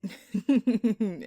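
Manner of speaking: loud, mocking villain's laugh; a loud teasing burst of laughter. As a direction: laugh at the detective's expense.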